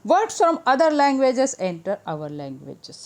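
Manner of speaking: slow textbook reading; slow read-aloud. Acted. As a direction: read it out slowly.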